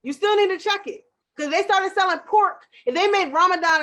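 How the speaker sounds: rising fired-up rant; heated rant. Real, not acted. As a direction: push the point hard and fast.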